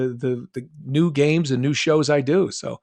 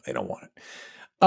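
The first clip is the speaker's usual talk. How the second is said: low whispered mock; 14 semitones lower, 6 dB quieter, slower than usual. Mock developer voice.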